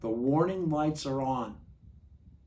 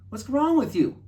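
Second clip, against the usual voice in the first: much higher and louder mock yell on "wrong"; friends yelling; 13 semitones higher, 12 dB louder than usual. Friends in nightmare yell at him, loud mock yell. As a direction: bellow "What's wrong with you?" with "wrong" punched high and loud. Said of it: "they're saying".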